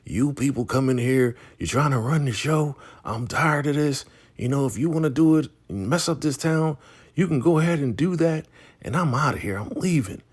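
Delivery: high-pitched indignant rant; acted fed-up outburst, high and forceful; mock sheriff going off. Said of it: "So he's going off".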